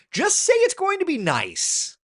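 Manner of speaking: high, loud exasperation; exasperated. Real, not acted. Telling forecasters to drop jargon, loud and exasperated. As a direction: plead for plain talk, fed up.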